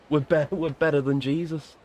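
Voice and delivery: joking mock Liverpool accent. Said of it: "doing the fucking Beatles impression".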